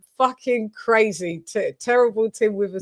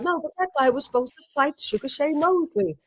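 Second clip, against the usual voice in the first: higher, quieter Tim voice; imitating Tim; 5 semitones higher, 5 dB quieter than usual. Host imitates Tim mixing up Shane. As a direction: play the confused old boxer. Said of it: "then he was like".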